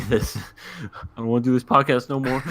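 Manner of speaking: jokey plea breaking into laughs; laughing mock plea. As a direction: laugh through a pretend complaint. Joking imagined plea, laughing.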